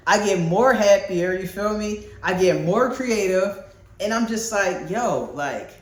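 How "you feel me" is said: normal talking voice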